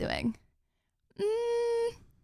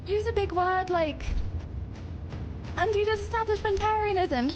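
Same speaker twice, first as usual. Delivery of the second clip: excited coaching; 8 semitones higher, slower than usual. Acted. Cheering coach.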